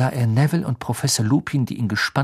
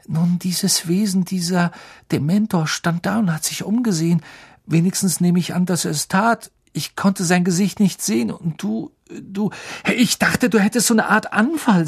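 put-on higher, unsettled boyish voice; scared Ron; 7 semitones higher than usual. A narrator's reading. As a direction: voice a frightened boy still shaken. Ron voiced as young boy.

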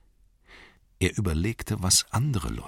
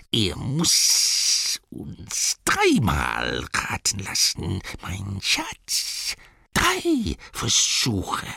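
Gollum creature voice; 3 semitones higher, much slower than usual. Acted. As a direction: drawl the terms like a scheming creature. Gollum sets terms. (langsame, listige Gollum-Stimme)

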